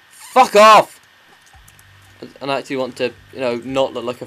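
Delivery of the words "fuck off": loud, higher-pitched burst of swearing; exasperated shout. Real, not acted. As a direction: yell it to be done with the game.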